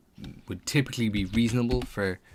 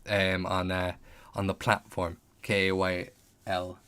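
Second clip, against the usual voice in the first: normal talking voice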